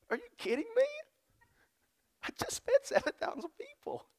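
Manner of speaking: high, soft incredulous Jesus; Jesus in mock disbelief; exasperated Jesus. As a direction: sound baffled at their doubt.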